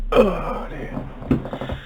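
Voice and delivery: normal talking voice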